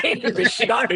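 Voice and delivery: giggling through the words; laughing. Retold or imitated. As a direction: laugh while quoting. Imitating Meredith, laughing.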